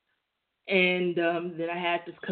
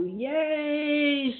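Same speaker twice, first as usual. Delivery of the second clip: mock cheer; 9 semitones higher than usual. Ironic cheer about rival's trouble.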